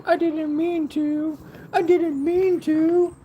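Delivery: very high, slow puppy voice; voicing the dog. Owner speaking for her guilty puppy, high pleading voice. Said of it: "She's like".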